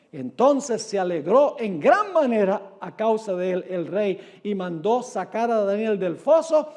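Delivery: ordinary delivery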